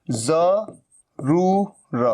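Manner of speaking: ज़रूर very slowly as "za, roo, ra"; z sound. Shown as a demonstration. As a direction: break ज़रूर into syllables, buzzing the z. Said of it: "जरूर बोलना है".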